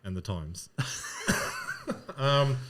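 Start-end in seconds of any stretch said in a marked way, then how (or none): 0.7-2.1 laugh. Real, not acted. Laughing at Epstein confusion.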